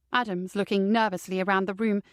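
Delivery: ordinary delivery, nothing marked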